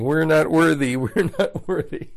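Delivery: hammed-up grovelling fan worship; Mike Myers in Wayne's World. Disciples worshipping Jesus, Wayne's World style mock chant. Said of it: "Be like Mike Myers and Dana Carvey".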